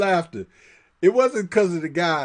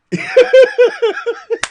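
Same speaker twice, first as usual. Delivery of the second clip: loud, very high cackle of laughter; bursting out laughing; 7 dB louder than usual. Real, not acted. Laughing after his point.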